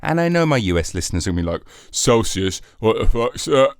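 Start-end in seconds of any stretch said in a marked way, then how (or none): 1.9-3.7 a put-on American accent, baffled; American accent, mock outrage. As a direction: play a confused American at the word Celsius. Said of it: "they won't be saying it in that accent".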